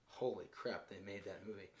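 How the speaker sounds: low, soft mock astonishment; hushed disbelief. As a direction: drop to an undertone as if stunned. Imitating an astonished viewer.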